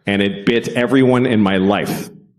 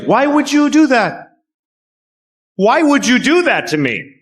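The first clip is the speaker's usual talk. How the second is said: much higher, wounded pet voice; dog's voice; 13 semitones higher than usual. Retold or imitated. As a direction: voice the betrayed dog, high and plaintive. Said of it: "your dog looks at you like".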